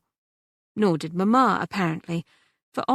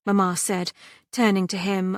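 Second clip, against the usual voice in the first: plain reading voice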